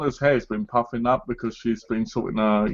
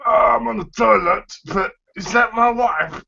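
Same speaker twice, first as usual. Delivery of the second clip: constipated husband; 10 semitones higher than usual. Pretend. Husband clowning as constipated while claiming his wife, mock straining. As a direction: squeeze it out high, as if straining on the toilet. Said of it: "makes you look constipated".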